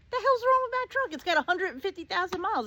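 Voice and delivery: high, loud outraged disbelief. Retold or imitated. To the boyfriend about her truck, high outraged disbelief. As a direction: fire it back at him in shock.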